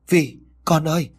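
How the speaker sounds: high, urgent call to her daughter; acted anguished motherly call; dreaming mother's call. A narrator's reading. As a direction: cry out from a nightmare. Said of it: "Lơ mơ nói quáng".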